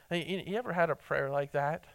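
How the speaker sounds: normal talking voice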